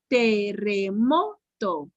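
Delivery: "Terremoto" in slow separate syllables, MO punched. Shown as a demonstration. Teacher helping pupils find the stress, "Terremoto" slowly split with MO stressed. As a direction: stretch "Terremoto" out, pausing before a stressed MO. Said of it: "la pronuncien por sílabas despacito".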